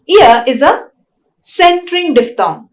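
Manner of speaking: ordinary delivery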